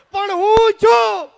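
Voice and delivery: high, very loud, long-held yell; exasperated husband at full volume; exasperated shout. Acted. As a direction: bellow each word out slowly. Uncle yells at wailing aunt.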